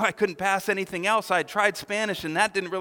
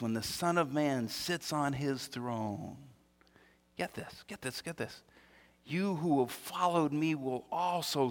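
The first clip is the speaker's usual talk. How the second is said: solemn Jesus quote; 6 semitones lower, 6 dB quieter, slower than usual. Retold or imitated. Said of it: "he says this".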